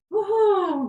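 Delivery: high, loud whoop of joy; excited cheer. Real, not acted. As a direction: celebrate with a big whoop. Celebrating end of knee sequence.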